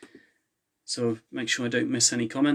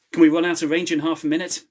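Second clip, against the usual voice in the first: higher, louder, breathless urgency; urgent raised question; 6 semitones higher, 6 dB louder than usual. A narrator's reading. Charlie asking mid-escape, higher and louder. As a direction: ask it while running.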